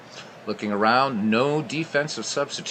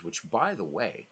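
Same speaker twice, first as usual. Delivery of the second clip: usual speaking voice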